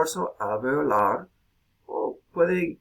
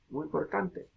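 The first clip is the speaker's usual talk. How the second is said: ordinary delivery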